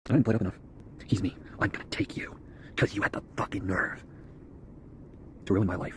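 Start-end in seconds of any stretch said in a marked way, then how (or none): 1.6-5.9 low unvoiced menacing whisper. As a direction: hiss the threat in a breathy hush. Threatening the inner enemy.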